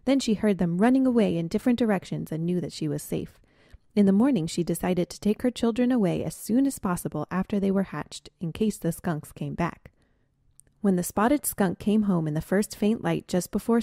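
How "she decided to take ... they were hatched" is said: usual speaking voice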